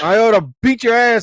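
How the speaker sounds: high, loud threatening yell; yelling at the drive-thru worker to step out; angry shout. Retold or imitated. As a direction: threaten them at full volume.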